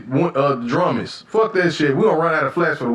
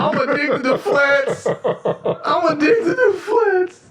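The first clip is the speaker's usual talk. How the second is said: high, slow, laughing confession; slower than usual. Real, not acted. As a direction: crack up while confessing it. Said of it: "I'm sorry, y'all".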